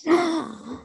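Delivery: short audible gasp. Real, not acted. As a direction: suck in a quick breath. Reacts to video being found.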